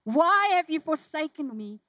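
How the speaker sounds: loud, high cry of anguish to God; crying out forsaken. Pretend. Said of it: "when we say".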